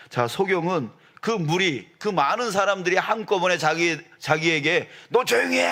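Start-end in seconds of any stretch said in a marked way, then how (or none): 5.1-5.6 high, raised-voice mock rebuke; shouted scolding. Crowd scolding the blind man, high sharp shout. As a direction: shush him with an acted yell. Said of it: "라고 하면서 꾸짖을".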